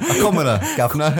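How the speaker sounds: jokey Cockney London twang, laughing; London accent. Pretend. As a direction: play it up like a London cabbie. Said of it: "You have to say it in, like, a London accent".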